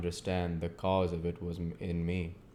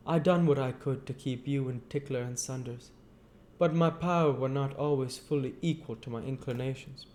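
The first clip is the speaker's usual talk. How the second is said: higher-pitched Joe, earnest and homely; Joe's voice; 7 semitones higher than usual. Acted. Joe talking gently to old Pip, in a higher voice. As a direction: pitch up into Joe, a plain simple man.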